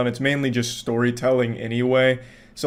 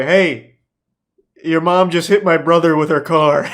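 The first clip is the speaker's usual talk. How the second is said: loud, angry accusing caller; scammer's shout; 3 semitones higher, 7 dB louder than usual. Retold or imitated. Scammer on the phone accusing him, shouting. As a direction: bark it like a furious stranger. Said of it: "he's like".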